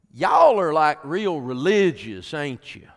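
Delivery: high, loud country drawl, slowed on "like, real religious"; backwoods country wife. Retold or imitated. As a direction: play the shy country wife finally blurting it out. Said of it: "she finally speaks up and she goes".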